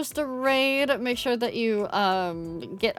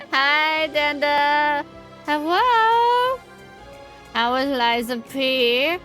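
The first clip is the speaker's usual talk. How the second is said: cute anime streamer; 6 semitones higher, 4 dB louder, slower than usual. Real, not acted. Streamer greets chat brightly.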